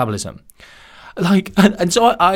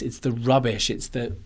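ordinary delivery

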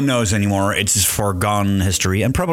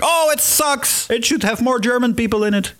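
mock angry listener; 12 semitones higher than usual. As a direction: whine like a grumpy German listener. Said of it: "it's like".

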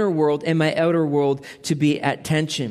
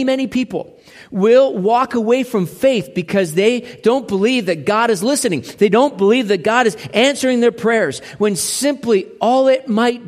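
impassioned preaching; 4 semitones higher, 4 dB louder than usual. Acted. Preaching builds in force.

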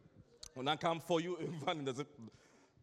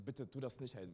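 low, hushed begging; imitated small, softly voiced plea; soft pleading; 5 semitones lower, 11 dB quieter than usual. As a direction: plead meekly under your breath. Boy pleads with angry teacher.

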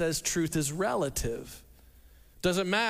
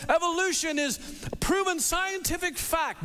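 quoted skeptic; 7 semitones higher than usual. Retold or imitated. Skeptic quoted, pitched high.

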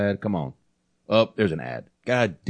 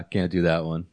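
ordinary delivery

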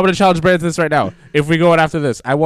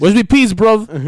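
higher, louder mock-British shout; British grime accent; 7 semitones higher, 6 dB louder, much slower than usual. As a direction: heckle in a put-on London British accent. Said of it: "he's fucking grime right now".

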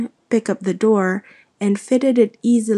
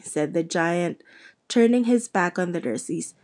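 plain reading voice

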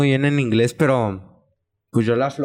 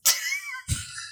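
high laugh; 10 dB quieter than usual. Real, not acted. Laughs at accent on six.

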